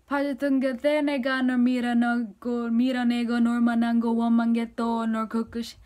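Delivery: rapid-fire imitation of the singer's line; fast sung-rap phrasing, lightly imitated; singer's rushed line. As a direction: rattle it off fast like the song. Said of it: "this is the part where he goes like".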